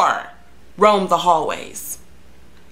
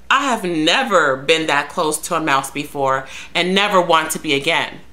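ordinary talk

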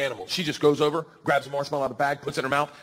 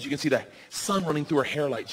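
ordinary talk

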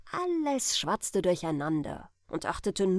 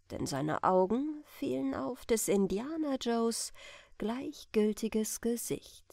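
usual speaking voice

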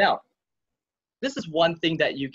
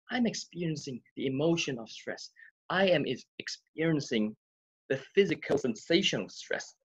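softer, measured self-observation; softer self-talk; 5 dB quieter than usual. Shown as a demonstration. Coach modelling quiet self-talk for viewers. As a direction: speak it gently to yourself. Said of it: "you can ask yourself these questions".

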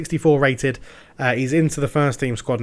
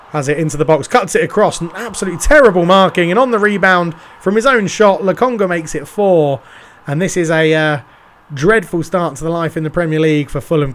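loud, higher commentator goal shout; excited goal call; 4 semitones higher, 5 dB louder than usual. Acted. Commentator calling Lokonga's goal, loud and excited. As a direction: lift the voice as the rebound goes in.

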